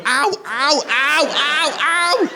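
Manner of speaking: high, repeated "ow" yelps; child's pained yelps. Retold or imitated. Son while dad picks it off, mock pained yelps. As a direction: imitate a kid yelping at each pick. Child yelping as poo picked off.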